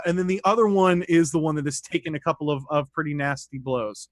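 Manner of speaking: ordinary delivery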